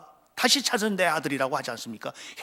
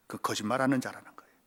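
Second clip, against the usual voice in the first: much softer, near whispered; hushed; 7 semitones lower, 8 dB quieter, faster than usual. Real, not acted. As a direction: drop to a hush. Grave statement. (속삭이듯 낮게)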